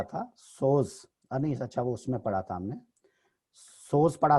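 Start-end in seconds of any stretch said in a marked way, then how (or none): none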